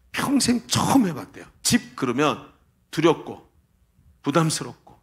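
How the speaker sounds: ordinary talk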